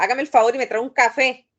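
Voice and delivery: loud, fast, commanding request; curt demand for coffee; bossy order. Shown as a demonstration. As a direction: snap it out like an order to the helper. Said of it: "por la forma, la entonación".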